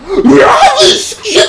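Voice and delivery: high, drawn-out yell; tandem shout. A narrator's reading. Two gamers shouting together as they attempt the skip, high drawn-out shout. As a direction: belt it out in a loud, high, stretched cry. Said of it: "They shouted in tandem".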